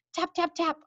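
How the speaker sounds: high, crisp tap imitation; boy tapping the bottle on the door. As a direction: imitate knocking.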